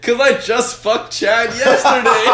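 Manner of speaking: high, loud, drawn-out girl impression; mimicked girl's confession. Mimicking a girl confessing to his friend, high and laughing. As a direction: imitate her slowly, cracking up at the end.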